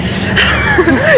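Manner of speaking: high squealing laugh. Real, not acted. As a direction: burst into a giggle. Laughing at friend.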